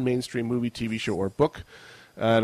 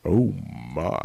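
"Oh, my." much lower, croaky and seductive; sick voice; 5 semitones lower than usual. Pretend. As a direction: purr "Oh, my." in a deep sick rasp. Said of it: "power of my, uh, sick voice".

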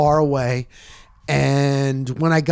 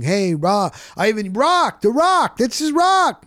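calling out; 6 semitones higher than usual. Retold or imitated. Calling out to The Rock.

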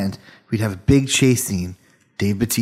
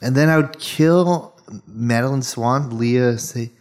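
normal talking voice

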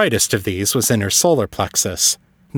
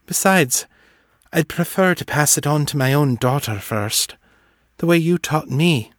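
put-on higher-pitched young woman's character voice; higher voice, gently insisting she's content; reassuring daughter; 5 semitones higher than usual. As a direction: reassure him in a lifted, feminine voice.

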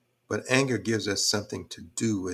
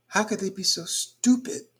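much higher, incredulous "how could they"; exasperated disbelief; 10 semitones higher, 3 dB louder than usual. Retold or imitated. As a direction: throw up your hands at them. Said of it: "And I keep saying".

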